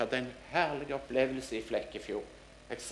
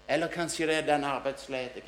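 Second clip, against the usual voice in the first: ordinary talk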